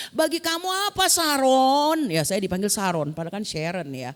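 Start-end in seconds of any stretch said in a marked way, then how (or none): 0.1-2.1 high, loud, drawn-out challenge; loud singled-out question in a raised pitch; teacher's pointed question. Retold or imitated. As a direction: put her on the spot, stretching the words. Teacher questions her before class.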